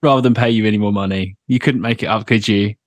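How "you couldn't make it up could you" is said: normal talking voice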